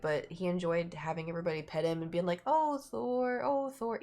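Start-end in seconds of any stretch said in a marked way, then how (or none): 2.5-4.0 high, drawn-out pitying coo; cooing sympathy. Retold or imitated. Everybody petting a lethargic cat, cooing sympathy. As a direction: imitate a crowd fussing over a pet.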